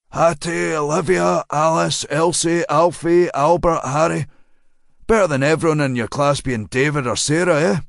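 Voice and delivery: a hint of an older man reeling off names; rattling off a list, pleased with himself; lightly voiced chatty driver. A narrator's reading. Driver lists old names to the girl, lightly voiced.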